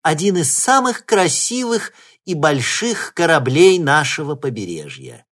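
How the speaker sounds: high, loud ceremonial host voice; solemn speech. Acted. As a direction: announce it with pride. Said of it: "продолжала Белохвостикова".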